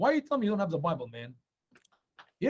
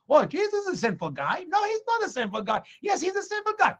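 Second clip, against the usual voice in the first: high-pitched mock back-and-forth argument; acted squabble; 12 semitones higher than usual. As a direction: contradict as if in a quarrel, pitch climbing. Acted quarrel among the Pharisees.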